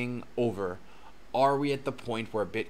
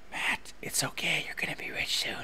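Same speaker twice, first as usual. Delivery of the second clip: whisper; 5 semitones higher than usual. Shown as a demonstration. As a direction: whisper it like a comforting secret. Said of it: "I want someone to whisper in my ear".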